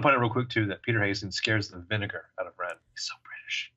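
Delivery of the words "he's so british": whispered British aside. Real, not acted. Host whispering a joke aside to cohost. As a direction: breathe it like a secret. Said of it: "whispered aside".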